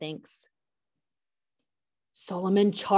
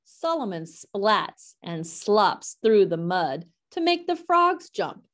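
plain reading voice

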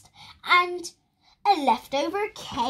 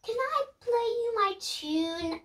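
put-on much higher, a small boy asking sweetly; high child voice; 10 semitones higher than usual. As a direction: ask like an eager little boy. Lester asks to play his tune.

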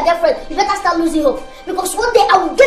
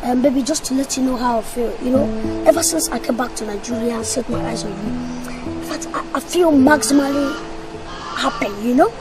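soft wooing; 6 semitones lower, 8 dB quieter than usual. Acted. Wooing brother's girlfriend.

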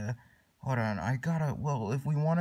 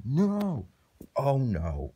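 dismayed protest; 3 dB louder, much slower than usual. Pretend. Sent to back of line, wailing protest. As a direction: cry out in play dismay.

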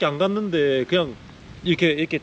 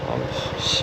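cursing under breath; 4 dB quieter, much slower than usual. Real, not acted. Muttering after the crash.

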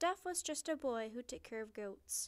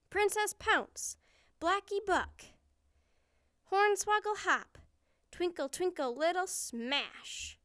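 high, loud, punched-out attack calls; battle cries; 7 semitones higher, 7 dB louder than usual. A narrator's reading. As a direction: call out each fighting move heroically. Princess shouts her battle moves.

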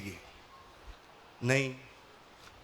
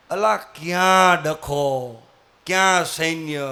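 normal talking voice

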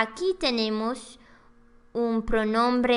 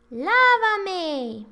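high, loud pleading voice; acted meme line; 7 semitones higher, 3 dB louder than usual. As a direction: cry it out like the meme character. Meme voice, washing command.

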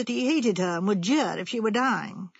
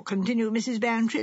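plain reading voice

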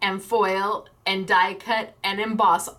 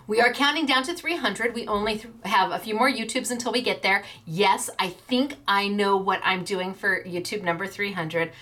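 ordinary talk

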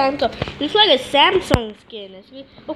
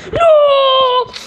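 high, loud cry of dismay; anguished shout; 10 semitones higher, 5 dB louder than usual. Real, not acted. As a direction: yell No in despair. Loses his tail in game.

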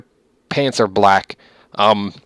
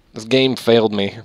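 ordinary talk

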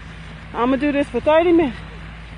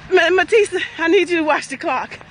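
normal talking voice

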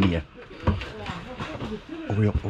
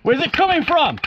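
shouting; 11 semitones higher than usual. Real, not acted. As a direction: yell the question over gunfire. Shouting under fire.

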